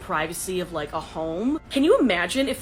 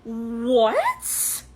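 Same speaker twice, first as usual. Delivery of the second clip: loud, rising "What?" in disbelief; 3 semitones higher, 5 dB louder than usual. Real, not acted. Hearing the date ask for a kiss, loud and stunned. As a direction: react as if you can't believe your ears. Shocked at his kiss request.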